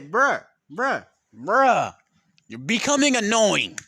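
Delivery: stretched-out, irritated complaint; mounting acted annoyance; annoyed. Complaining at the noisy classmate.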